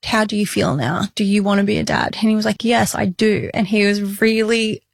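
normal talking voice

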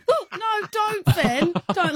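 high mock-frightened pleading; high hammed-up fear; mock scared. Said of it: "I'd be going".

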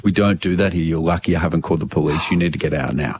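low, fast, hushed warning; stern barman. Retold or imitated. Barman telling the customer to leave, a low hushed threat. Barman voiced in retold story.

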